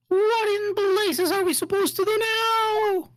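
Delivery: high, drawn-out cry of dismay; exasperated game character. Acted. Court character faced with missing evidence, high exasperation. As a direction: wail it high and slow, in character.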